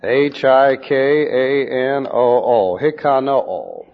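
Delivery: letter by letter, then Hikanoo said slowly as hee-kah-NO-oh; spelling out the Greek. Shown as a demonstration. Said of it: "Looks like this in the Greek".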